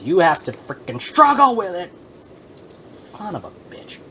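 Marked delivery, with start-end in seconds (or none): 2.8-4.0 under-the-breath curse; whispered curse. Real, not acted. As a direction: hiss it out quietly. Frustrated aside.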